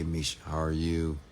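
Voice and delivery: normal talking voice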